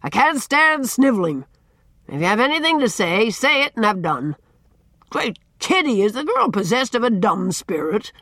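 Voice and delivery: loud, high, exasperated tirade; shouting. A narrator's reading. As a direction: bellow impatiently at a tongue-tied child. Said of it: "could have been heard at the harbor".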